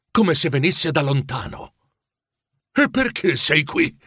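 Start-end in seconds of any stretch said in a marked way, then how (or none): 2.7-4.0 high, slow insistent question; pressing question. A narrator's reading. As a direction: press the ghost for an answer. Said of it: "incalzò il vecchio".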